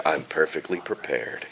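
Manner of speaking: deep, soft character voice; low cautious hero. Acted. Hero's catchphrase, low and soft. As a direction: quietly drop it down low. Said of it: "he'll always say".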